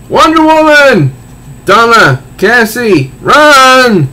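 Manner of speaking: hammed-up comic-book cry to run; panicked warning yell. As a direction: scream the warning across the battlefield.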